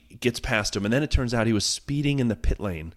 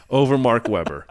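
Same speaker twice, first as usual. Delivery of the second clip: ordinary talk, nothing marked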